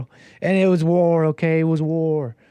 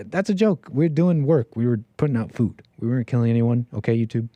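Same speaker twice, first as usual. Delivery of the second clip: ordinary talk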